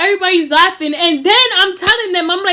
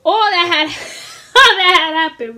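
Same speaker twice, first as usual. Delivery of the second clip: laughing while talking; 2 semitones higher than usual. Real, not acted. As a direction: fight back a laugh. Laughing mid-story.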